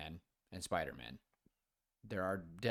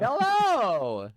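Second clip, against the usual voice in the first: big, high, loud drawn-out hello; cartoonish greeting; 12 semitones higher, 13 dB louder than usual. Real, not acted. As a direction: belt out a cartoon-style greeting. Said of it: "saying hello like that".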